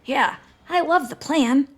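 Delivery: high, slow, uneasy character voice; stretched-out, high, nervous enthusiasm; nervous agreement. A narrator's reading. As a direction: agree as if trying to convince yourself. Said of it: "Grover gulped".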